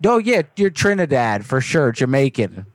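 ordinary delivery

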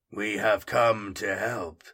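slow, deep, compelling warrior voice. A narrator's reading. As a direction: speak slowly with grave calm. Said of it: "His voice was deep and compelling".

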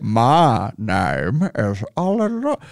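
slow, lightly put-on Korean accent; half English, half Korean lilt, slowly; a touch of put-on Korean accent. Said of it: "they kind of have this half and half".

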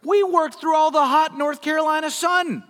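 high, loud complaining laborer; whiny grumbling worker. Acted. First-hired workers grumbling to master, whiny high complaint. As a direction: moan about the hot sun.

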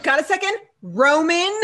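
Roman? stressed with a pointed high rise. Retold or imitated. Antonio pointedly calling out Roman, Roman? stressed and rising. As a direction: lean hard on the name Roman?. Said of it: "puts the emphasis on it".

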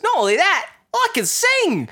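very high, gleeful kangaroo voice; cartoon kangaroo. Animated kangaroo boasts to Jackie of his talents, high and excited. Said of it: "I love the line where he's like".

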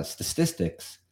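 ordinary delivery

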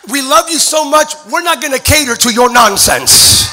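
loud, ringing sermon climax; a preacher roaring over the organ; full-force preaching. Acted. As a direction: preach it to the rafters.

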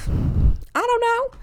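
very high, drawn-out rising answer; clueless singsong reply. Acted. Candidate asked about football by interviewer, airily clueless. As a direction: stretch it upward in mock cluelessness. Acted reply to sports small talk.

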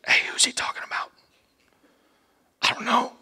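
unvoiced, hushed whisper. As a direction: whisper it across the table. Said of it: "they start asking each other".

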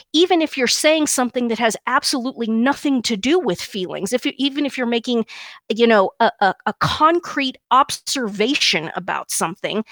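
normal talking voice

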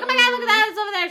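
high, loud retold exclamation; excited outburst. Friend points out something while in the car, excited outburst.

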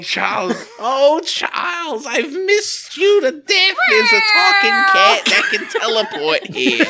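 high, laughing mock sob; hammed-up soap opera weeping; melodramatic lover. Melodramatic reunion with Charles.

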